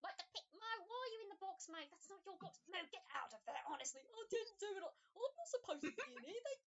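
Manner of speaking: high, loud building yell of protest; panicked shout. Acted. Mo protests from the box.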